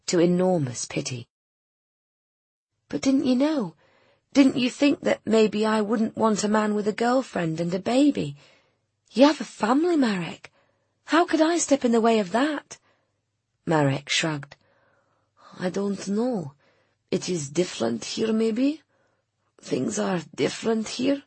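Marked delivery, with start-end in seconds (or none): 2.9-12.8 much higher, dismayed woman's voice; pitying, questioning woman. A narrator's reading. Voices Nina confronting Marek with pity. 15.6-21.2 put-on higher, tentative foreign-accented man; hope timidly, lifting into a question; cracking with hope. A narrator's reading. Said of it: "His voice was cracking with hope".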